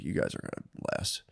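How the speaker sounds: whispered, breathy, low and soft; mock whisper of wise warning; hushed retold advice. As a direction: pass it on like a quiet secret. Friend's sober advice, retold hushed.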